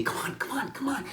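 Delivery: high, soft, breathy coaxing; beckoning call. Retold or imitated. Little imp beckoning the storyteller toward the lagoon, high and hushed. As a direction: lure him along in a high hush. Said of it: "beckoned me".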